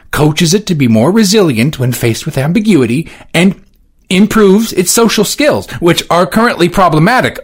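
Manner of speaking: high, loud clarifying; formal Johnson. Acted. Johnson clarifying to the board. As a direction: explain precisely.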